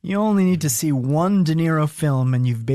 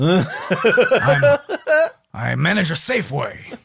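Pacino as Corleone; 2 semitones higher, much slower than usual. Retold or imitated. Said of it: "in Pacino's mind Michael Corleone would be like".

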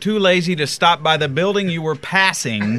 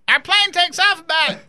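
whiny mock holler, pitched way up; John Boy; 12 semitones higher, slower than usual. Imitating John Boy's phone call about the sweatshirt, whiny and hollery. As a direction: mimic a demanding caller in a high, drawn-out whine. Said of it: "It's whiny and hollery".

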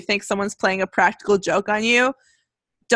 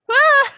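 startled yelp; 8 dB louder than usual. Acted. Startled scream at the twist.

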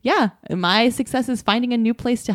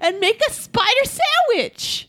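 very high silly voice, falling at the end; getting crazier; 12 semitones higher than usual. Pretend. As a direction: top it off with a high, silly flourish. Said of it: "it's getting even more crazy".

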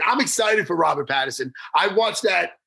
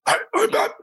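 false start in a strained, high hero voice; put-on vigilante voice that breaks off; botched superhero impersonation. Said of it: "now I'll do it".

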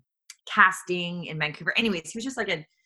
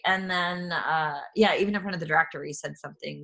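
ordinary delivery

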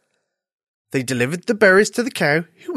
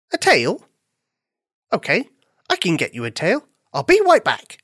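high-pitched bunny, puzzled then eager; little bunny, obliging; 4 semitones higher than usual. Acted. Bunny agreeing to fetch the dog a tale, obliging.

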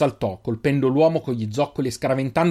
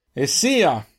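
mildly raised, curt call; shouted assent. A narrator's reading. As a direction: call back with finality. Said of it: "urlò ancora Deinar". (grido di sfida, contenuto)

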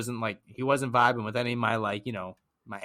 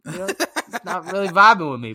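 laughing through the line, higher and louder; 7 semitones higher, 8 dB louder than usual. Retold or imitated. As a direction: chuckle it out, amused. Laughing retelling of his reply.

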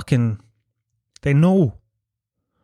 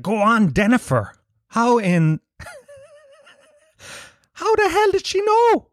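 high-pitched, hammed-up disbelief; retold astonishment, pitched way up; amazed reaction. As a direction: act out being astounded, voice jumping up. Retells his astonishment at the news.

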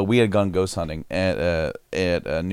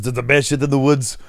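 Schwarzenegger voice; 6 semitones higher, 4 dB louder than usual. Retold or imitated. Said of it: "He goes".

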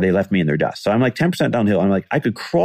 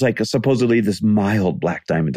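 usual speaking voice

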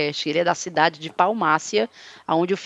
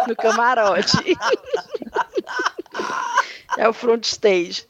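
laughing; 5 semitones higher, slower than usual. Real, not acted. Laughing at her own joke.

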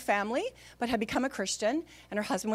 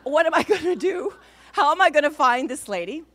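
raised, louder worried self-questioning; mock panic; 4 semitones higher, 6 dB louder than usual. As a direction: act out being at a loss, pitched up. Acted worry while searching for the patient.

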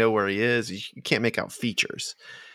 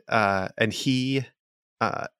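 normal talking voice

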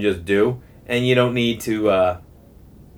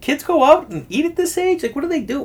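much higher, wide-eyed disbelief; amazed inner voice. Retold or imitated. Watching polite kids order breakfast, a high amazed squeak. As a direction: squeak it out in pure astonishment. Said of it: "I'm like".

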